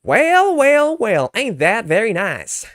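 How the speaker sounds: loud, high Southern drawl; Southern accent. Shown as a demonstration. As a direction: belt it out in a hammed-up Southern accent. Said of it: "let's try Southern accent".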